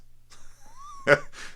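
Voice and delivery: high burst of laughter; a quick high laugh; real laugh. Real, not acted. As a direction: laugh out loud at yourself. Laughs at his own line.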